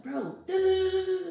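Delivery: reliving a terrified yell down the line; panicked scream. Retold or imitated. Lost girl on phone to family, panicked screaming. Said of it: "screaming 'cause I'm scared".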